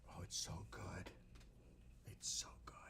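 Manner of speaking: hushed and longing; a whispered so good; craving whisper. Real, not acted. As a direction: whisper it, dreamy and hungry. Reminiscing about the gumbo's taste.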